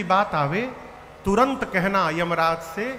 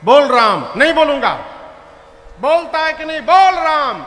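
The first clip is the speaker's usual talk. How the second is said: loud, higher back and forth; heated quarrel; 6 semitones higher, 6 dB louder than usual. Acted. Guru and son shouting over saying Ram. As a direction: shout the stubborn refusals.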